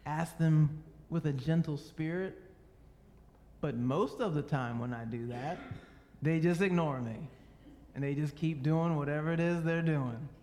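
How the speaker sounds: ordinary delivery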